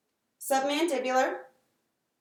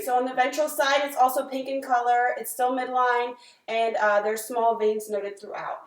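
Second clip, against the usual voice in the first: clinical dictation; 6 dB louder than usual. Acted. Dictating exam findings aloud.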